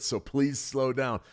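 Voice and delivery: slow right down, spacing each word; slow and deliberate. Shown as a demonstration. Streamer to a chat viewer, drawn-out slow delivery. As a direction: model the calm pace you're asking for. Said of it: "take a breath".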